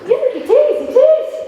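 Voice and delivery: high eager chorus; chorused reply. Retold or imitated. Friends eagerly answer the boyfriend, high mock chorus. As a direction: chime in brightly together, high. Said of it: "We chorused".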